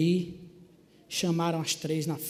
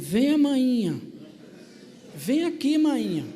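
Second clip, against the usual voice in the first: higher, louder call across the church; 6 semitones higher, 3 dB louder, slower than usual. Retold or imitated. Daughter calling her mother to the front, loud and high.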